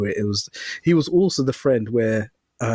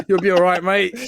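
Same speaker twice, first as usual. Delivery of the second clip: louder, higher blokey consolation; mock matey reassurance; 5 semitones higher, 4 dB louder than usual. Blokes consoling an injured mate, mock matey reassurance. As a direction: play the hearty mate brushing it off.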